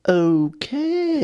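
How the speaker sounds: high, drawn-out okay; mock bewilderment. Clerk watching thief pocket dropped steak, baffled. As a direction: stretch it out in baffled acceptance. Acts out reaction to shoplifter.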